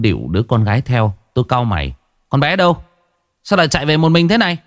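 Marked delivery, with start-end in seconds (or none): 2.3-4.6 urgent frowning question. A narrator's reading. Narrator frowning, questions couple.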